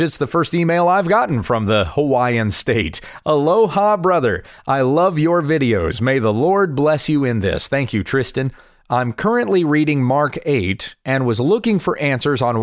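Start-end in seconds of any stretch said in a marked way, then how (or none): none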